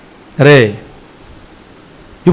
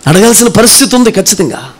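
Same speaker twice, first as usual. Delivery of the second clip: normal talking voice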